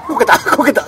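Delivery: sudden high yelp of surprise; surprised. Real, not acted. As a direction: blurt it out, startled. (びっくりして叫ぶ)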